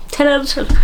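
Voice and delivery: high-pitched Northern Irish; proudly hammed-up Northern Irish, high; Northern Irish accent. Vlogger rating her own attempt, high Northern Irish. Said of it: "northern Irish accent".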